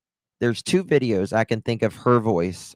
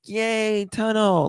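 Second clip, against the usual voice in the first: put-on a high, gleeful little kid's cheer; excited kid; 10 semitones higher, slower than usual. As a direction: squeal it like a kid thrilled by a tunnel. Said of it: "they would be like".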